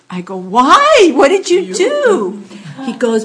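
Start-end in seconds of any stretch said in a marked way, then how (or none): 0.4-2.4 surprised. Retold or imitated. Retelling her surprised reply.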